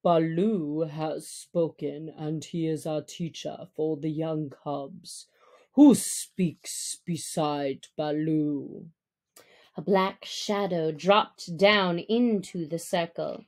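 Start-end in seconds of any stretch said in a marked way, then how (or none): 0.0-8.9 low, stern pack leader; measured, deep command to the wolves; Akela. Acted. Leader wolf warning the free people, low and stern.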